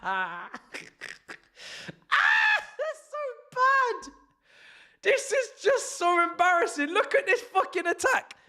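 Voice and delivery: helpless, very high squealing laughter; laughing so hard it sounds like sobbing; crying with laughter. Real, not acted. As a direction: squeal the words through hysterics. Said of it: "I'm actually crying".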